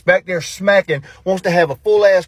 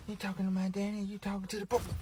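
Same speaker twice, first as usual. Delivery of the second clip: soft, high little-kid imitation; hushed mocking echo; mocking the son; 4 semitones higher, 17 dB quieter than usual. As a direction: mimic a child in a small, raised voice. Mimicking son's question.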